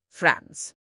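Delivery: ordinary talk, nothing marked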